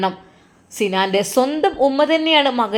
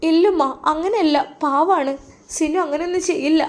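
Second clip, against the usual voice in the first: put-on a lightly raised, pleading young woman; young wife's voice; 7 semitones higher than usual. Young wife defends husband. (ഉയർന്ന ശബ്ദത്തിൽ അപേക്ഷിക്കുന്ന യുവതി)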